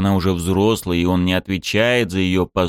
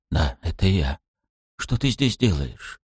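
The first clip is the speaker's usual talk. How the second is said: slightly breaking voice. A narrator's reading. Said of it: "слегка надломленным голосом".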